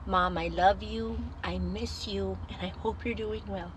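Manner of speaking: slow, hushed, sincere delivery; tender. Retold or imitated. Daughter repeating her text to mom: soft, slow tenderness. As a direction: say it slowly and softly, with feeling.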